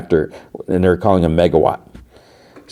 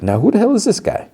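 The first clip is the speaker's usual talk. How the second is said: high-pitched mock bewilderment; baffled onlooker, imitated without extra volume; incredulous, retold. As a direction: question it in acted disbelief, pitch soaring. Robbie reacts to the new villain.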